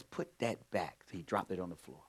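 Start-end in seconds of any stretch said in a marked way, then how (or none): 0.0-1.0 low-key, slow and soft order; calm quiet command. Retold or imitated. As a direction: tell him quietly but firmly, slowly. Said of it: "Now I didn't raise my voice".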